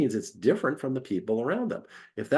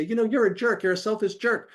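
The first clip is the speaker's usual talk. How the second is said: higher, hammed-up accusing voice; mock angry accusation; 10 semitones higher than usual. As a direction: play an upset partner calling names. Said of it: "When they say".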